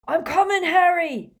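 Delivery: high, loud call; yelled. Acted. Hagrid calling to Harry from the darkness, loud yell. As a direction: call it across the dark sky. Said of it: "Hagrid yelled from the darkness".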